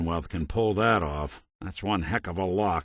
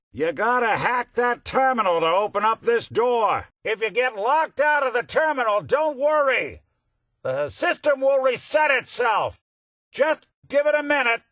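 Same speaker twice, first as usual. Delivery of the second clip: high-pitched shout, well above usual voice; shouted instructions; 4 dB louder than usual. Acted. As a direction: call out loudly through a closed door. Calling instructions through a locked door.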